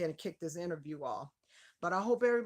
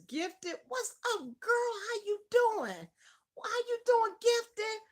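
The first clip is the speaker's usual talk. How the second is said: much higher, louder delighted hello; excited squealing greeting; 5 dB louder, slower than usual. Real, not acted. Host greeting her friend Gifted joining the live stream, thrilled high squeal.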